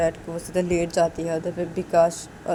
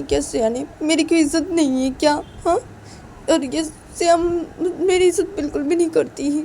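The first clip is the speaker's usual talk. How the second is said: high, hurt complaining voice, louder at the peaks; upset wife grumbling; 9 semitones higher, 4 dB louder than usual. Acted. Shruti's husband complains about the slap, high and hurt. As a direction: fume about being slapped in front of others. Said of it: "श्रुति जाते जाते अपनी बोली थी".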